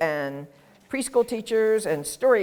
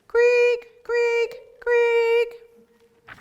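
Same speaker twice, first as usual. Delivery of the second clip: much higher loud creaks; creaking frog; 5 dB louder, much slower than usual. Shown as a demonstration. A teacher imitating chorus frog creaks. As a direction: creak it up high. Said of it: "creaking in a creek".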